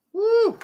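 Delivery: high whooping woo; excited woo. Real, not acted. Streamer hollers over the Southern voice, high whoop. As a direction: let out a high party whoop.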